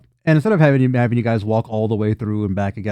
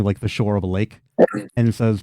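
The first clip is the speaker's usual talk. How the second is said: ordinary delivery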